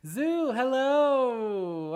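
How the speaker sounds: high, drawn-out, loud hello to a viewer; excited greeting. Real, not acted. Streamer calling out a viewer's name, excited. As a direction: sing out the welcome, slow and bright.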